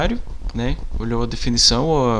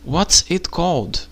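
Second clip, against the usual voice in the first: very slow question, a bit higher; slow English example; 5 semitones higher, much slower than usual. Shown as a demonstration. Teacher showing how to ask the seller, slow and clear. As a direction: model it slowly for the class. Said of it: "Por exemplo".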